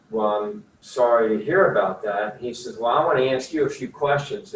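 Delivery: usual speaking voice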